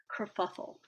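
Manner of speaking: usual speaking voice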